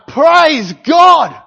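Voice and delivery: loud, high, ecstatic cries of praise; joyful praise shout. Retold or imitated. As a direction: shout praise to God over the storm. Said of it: "They're going".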